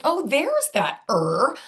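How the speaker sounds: high, slow voiced child finding the sound; imitating a child. Therapist voicing a child spotting the right R, high and slow. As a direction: slowly play a kid noticing the sound. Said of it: "start saying".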